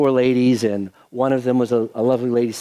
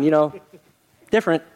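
usual speaking voice

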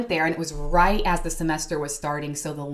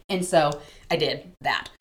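ordinary talk, nothing marked

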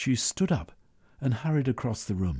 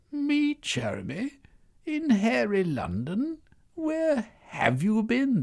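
high, slow teasing disbelief; amused woman voice; slower than usual. Acted. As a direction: tease him slowly. Semiotica mocking.

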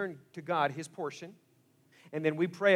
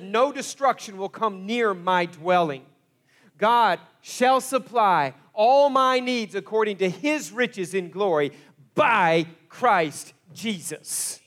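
loud, higher, slow and emphatic; declaring with authority; 3 semitones higher, 9 dB louder, slower than usual. Retold or imitated. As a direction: proclaim it like a battle cry. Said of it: "He is mad at something".

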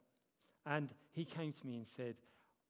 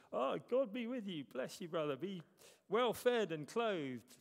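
much higher, loud sanctimonious blessing; mock pious blessing; 8 semitones higher, 9 dB louder than usual. Pastor parodying an empty blessing, high and pious. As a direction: ham up a hollow pious brush-off. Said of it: "I wanted to go".

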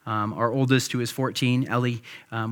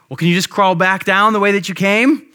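louder, higher coaxing call, acted out; dad calling up; 6 semitones higher, 6 dB louder than usual. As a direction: call a suggestion up to a stuck kid. Dad calling up to stuck child.

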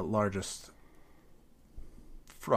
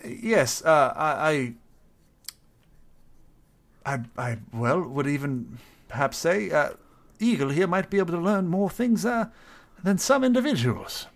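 courteous, slightly higher character voice; polite adventurer; 5 semitones higher, 7 dB louder than usual. Acted. Adventurer asking his eagle to scout the town, polite and fussy. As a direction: ask the bird politely, a little fussy.

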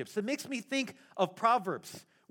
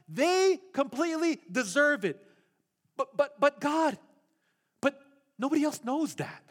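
higher, heated Moses pleading with God; paraphrased Moses; 5 semitones higher than usual. Retold or imitated. Moses pleading with God in the pastor's paraphrase, heated and insistent. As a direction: argue your case to God, worked up. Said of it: "what Moses was saying was".

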